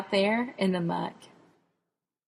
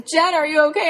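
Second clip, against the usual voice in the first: 1 calling out; 8 semitones higher, 5 dB louder than usual. Retold or imitated. Dad still calling.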